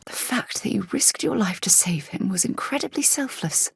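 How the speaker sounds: hushed whisper; breathy secret whisper. Acted. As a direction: whisper it close and private. Whispered companion line.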